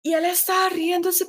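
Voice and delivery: ordinary delivery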